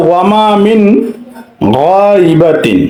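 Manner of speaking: slow chant of গাইবাতিন with the nun of মিন distinct; slow Quranic recitation showing izhar; clear recitation demo. Shown as a demonstration. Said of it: "গাইবাতিন স্পষ্ট".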